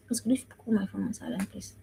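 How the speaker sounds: quick hushed request, well under the teaching voice; whispered aside. Real, not acted. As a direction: whisper a polite aside to a helper. Aside to someone off mic.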